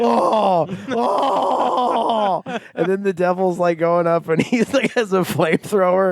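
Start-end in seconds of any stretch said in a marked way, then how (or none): 0.0-2.6 high wailing oh, oh of burning skeletons; mock wail. Skeleton voice wailing in hell fire, high cry. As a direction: cry out high like a damned soul.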